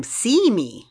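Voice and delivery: retold monster yell. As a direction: mimic a monster calling out. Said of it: "is yelling at him".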